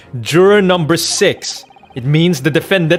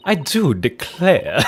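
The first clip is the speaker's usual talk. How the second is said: a hint of a Southern drawl on "I do declare"; slow, lightly Southern "I do declare"; southern belle accent; slower than usual. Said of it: "she has, like, a southern accent now".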